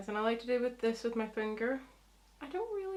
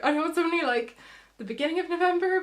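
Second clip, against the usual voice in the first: higher and louder, bubbling over with Christmas excitement; excited; 7 semitones higher, 8 dB louder, faster than usual. Real, not acted. Telling viewers about her Christmas makeup plans, giddy and excited. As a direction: gush it, rising and speeding up. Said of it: "I'm so excited for Christmas".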